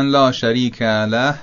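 لا joined on and له with a forced double lam; wrong doubled lam. Shown as a demonstration. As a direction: stick the words together and stress له. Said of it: "میچسبونن و با شدت و تشدید".

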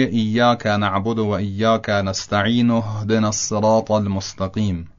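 slow, "اهدنا" read with its alif dropped; joined recitation. Shown as a demonstration. Teacher demonstrating the linked reading to students. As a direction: run "نستعین" straight into "هدنا", no pause. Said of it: "الف اهدنا رو باید بیاندازه، بگه".

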